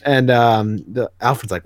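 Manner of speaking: ordinary delivery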